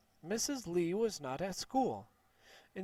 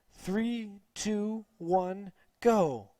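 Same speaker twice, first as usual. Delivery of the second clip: high count building to a loud go; race countdown; 4 semitones higher, 6 dB louder, much slower than usual. Acted. Teacher starting the kids' race, countdown to loud go. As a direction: count them down, then launch them.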